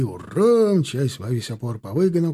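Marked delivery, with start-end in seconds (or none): 0.0-0.7 high, raised "hurrah"; lifted battle yell; Cossack war cry. A narrator's reading. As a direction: shout the charge as a galloping troop. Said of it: "кричала казачья сотня".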